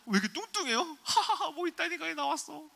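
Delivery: very high, loud imitation of laughing in disgust; incredulous mocking laughter. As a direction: mimic laughing because it's so awful. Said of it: "이렇게 웃은 거예요". (어이없어 높은 목소리로 웃는 흉내)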